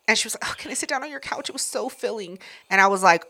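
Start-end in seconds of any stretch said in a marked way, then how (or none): 0.4-2.4 mock feeble guest. Imitating guest too full to stand.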